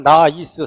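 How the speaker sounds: 나이스 split as 나, 이, 스; three-syllable Korean reading. Shown as a demonstration. Teacher shows the wrong count, 나이스 broken into three syllables. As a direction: say 나이스 loudly, each Korean syllable on its own. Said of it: "삼 음절 이러면 안 되고".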